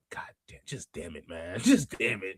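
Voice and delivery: breathy whisper under the breath; whispered curse. Real, not acted. Streamer fed up with the Bella news, whispered curse. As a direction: mutter it to yourself, fed up.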